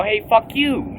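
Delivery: hammed-up street-fight insult; mock angry comeback. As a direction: fire it back at the guy below. Retold quarrel, his own retort.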